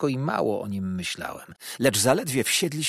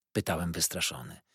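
plain reading voice

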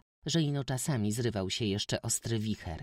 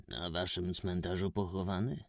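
grieving gentleman; 2 semitones lower than usual. A narrator's reading. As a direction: sigh over a search too late. Stranger voiced low.

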